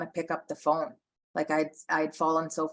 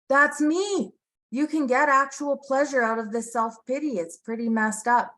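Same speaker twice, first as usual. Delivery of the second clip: excited realization; 9 semitones higher, 4 dB louder than usual. Real, not acted. Sudden self-recognition while reading.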